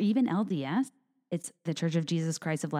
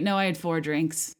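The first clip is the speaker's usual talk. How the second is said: ordinary delivery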